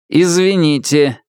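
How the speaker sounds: synthesized voice. Acted. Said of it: "синтезированный голос".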